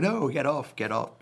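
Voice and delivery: high, hammed-up shooing away; mock alarmed refusal. Comedian acting out fleeing a toxic platform, mock alarm. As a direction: yelp it as if pushing something off. Acting out his recoil from Facebook.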